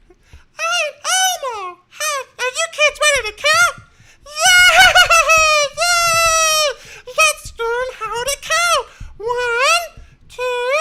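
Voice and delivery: very high constricted puppet falsetto; excited little puppet, shrieking with glee; Elmo. Acted. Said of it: "really constrict the voice".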